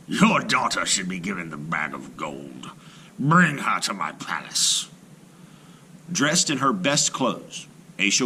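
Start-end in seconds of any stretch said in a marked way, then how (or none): 0.1-4.9 low, hushed royal command; stern order. A narrator's reading. The Sultan ordering Aisha brought to him, low stern command. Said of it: "then he ordered".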